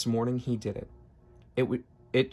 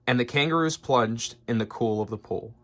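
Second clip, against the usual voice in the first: usual speaking voice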